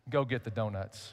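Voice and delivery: low, quiet, unsmiling order; a clerk's stone-faced instruction; deadpan order. Retold or imitated. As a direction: say it flat, not a flicker of a smile. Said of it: "Without breaking a smile".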